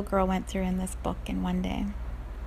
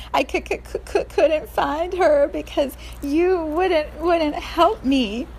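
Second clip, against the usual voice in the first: put-on stammering crying girl; 7 semitones higher, 3 dB louder, slower than usual.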